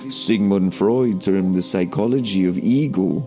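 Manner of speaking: usual speaking voice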